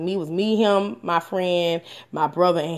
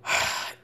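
soft, wordless breath out; heavy sigh; 8 dB quieter than usual. Real, not acted. Speaker pausing before advice on leaving, sighing. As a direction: let out a real sigh.

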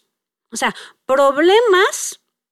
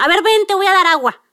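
quick, higher, irritated mother; annoyed mom; 5 semitones higher, 4 dB louder, faster than usual. Retold or imitated. Annoyed mother handing her son water, mock irritated and fast. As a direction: snap at the kid impatiently, hammed-up.

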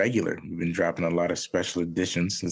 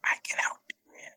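soft unvoiced whisper, much softer; whispered plea; 5 dB quieter than usual. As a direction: whisper it like a secret promise. Voicing the son's secret plea.

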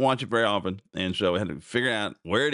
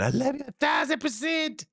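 mock gushing woman. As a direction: gush like a smitten contestant. Quoting gushing winning woman.

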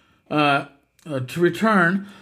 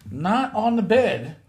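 annoyed scolding; 7 semitones higher, 4 dB louder than usual. Real, not acted. Scolding heckler in background.

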